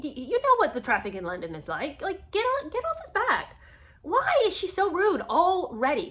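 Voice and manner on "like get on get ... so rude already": high, exasperated protest; indignant. Real, not acted. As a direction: get off his back, outraged and high. Defending Harry from his date.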